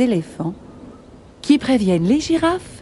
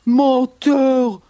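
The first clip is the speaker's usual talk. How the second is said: gruff, higher-pitched accusing growl; growling bears; 4 semitones higher, 3 dB louder than usual. Acted. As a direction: growl the insult like an angry bear. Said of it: "grognent les ours".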